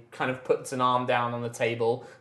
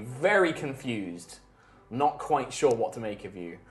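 ordinary delivery

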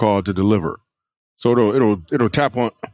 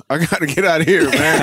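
normal talking voice

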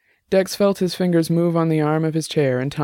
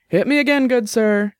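playful order; 9 semitones higher than usual. Acted. Jenny calling to the barman for another drink, playful. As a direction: call it out to the barman.

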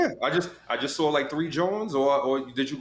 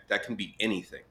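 normal talking voice

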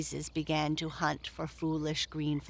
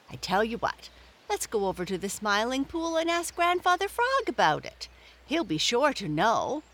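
high, fast eager suggestion; bright idea rushing out; excited; 6 semitones higher, 3 dB louder, faster than usual. Acted.

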